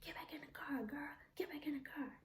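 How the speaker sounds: high hissed urgent whisper; insistent whisper. Retold or imitated. The abuser calling her back to the car, urgent high whisper.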